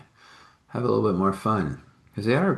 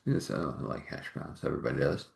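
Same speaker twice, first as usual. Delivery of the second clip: whispered aside; 6 semitones lower, 9 dB quieter than usual. Real, not acted.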